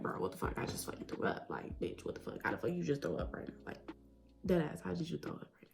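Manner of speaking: retold whispered cursing; very soft, mostly voiceless grumble; hushed disbelief. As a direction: curse under your breath in disbelief. Retold unspoken reaction while vomiting.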